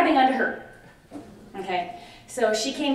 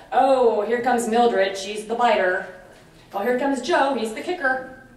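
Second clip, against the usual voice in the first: louder, hammed-up labelling of residents; mock staff gossip; 6 dB louder than usual. Trainer mimics staff labelling residents, loud mock sneer. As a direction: play a careless caregiver sneering at residents.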